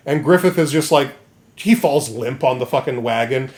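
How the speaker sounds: ordinary talk, nothing marked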